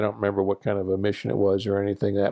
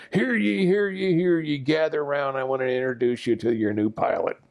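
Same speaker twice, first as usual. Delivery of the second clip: high proclamation, gradually falling to usual pitch; mock town crier; 4 semitones higher than usual. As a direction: call everyone round with mock ceremony. Mock crier's announcement to the guys.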